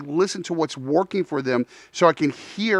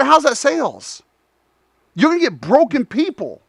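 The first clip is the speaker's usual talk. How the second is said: high, pushy retort in a retold argument; incredulous challenge; 7 semitones higher, 4 dB louder than usual. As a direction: challenge him in raised pitch. Retold argument with sales trainer.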